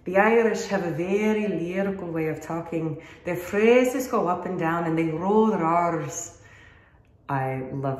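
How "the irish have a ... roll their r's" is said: lilting, lyrical Irish brogue; sing-song Irish lilt with rolled R's; Irish accent. Shown as a demonstration. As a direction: put on an Irish accent, phrases going up and down. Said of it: "I've been working on the accents".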